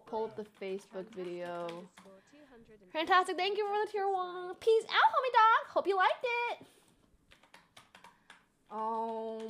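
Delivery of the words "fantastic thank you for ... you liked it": very high mocking chipmunk squeak. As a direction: parody her childhood sign-off squeakily. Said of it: "that was a chipmunk voice".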